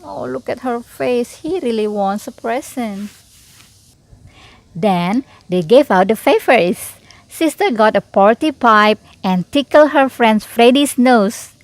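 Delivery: ordinary delivery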